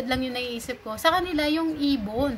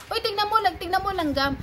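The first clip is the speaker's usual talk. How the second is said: imitating Bisaya friend; 8 semitones higher than usual. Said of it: "iba yung tono nung boses niya".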